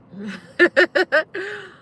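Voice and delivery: loud, high burst of laughter; real laugh. Real, not acted. Laughing to viewers about the cats stealing the daughter's toy. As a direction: laugh hard at how silly it all is.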